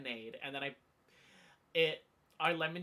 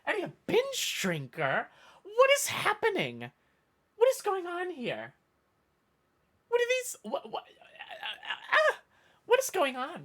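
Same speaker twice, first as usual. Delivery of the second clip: much higher, louder hammed-up disbelief with stammers; mock shock; 14 semitones higher, 8 dB louder than usual. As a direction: sputter like you can't believe the accusation. Mock shock at chat accusation.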